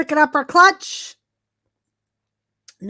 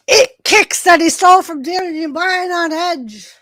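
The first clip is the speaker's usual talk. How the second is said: wrestling commentator; 2 semitones higher than usual. Acted. Live wrestling play-by-play.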